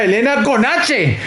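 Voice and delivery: high, slow, hammed-up telenovela wail; gasping soap opera drama. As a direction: cry betrayal like a soap heroine. Melodramatic betrayal over a letter.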